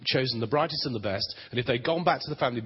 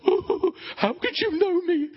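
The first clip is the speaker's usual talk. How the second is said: very high, slow, wailing mock astonishment; hammed-up falsetto amazement; amazed Nathanael; 5 dB louder, slower than usual. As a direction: play up Nathanael's awe like a cry. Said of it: "Nathanael goes".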